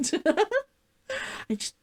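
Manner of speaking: normal talking voice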